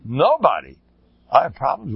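ordinary delivery

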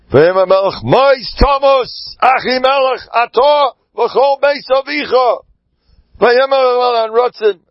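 a furious king condemning them; king's death sentence. Acted. King orders the priests killed.

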